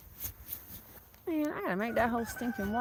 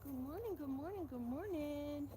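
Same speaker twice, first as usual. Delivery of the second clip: soothing a scared dog; 9 semitones higher than usual. Real, not acted. Cooing to animals at dawn.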